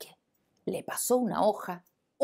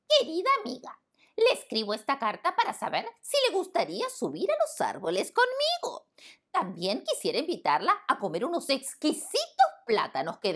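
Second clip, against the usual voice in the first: high monkey voice, reading grandly; proud monkey reading; 7 semitones higher than usual. Acted. Monkey reading his letter to the lion, proud and high. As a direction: read it puffed up with pride.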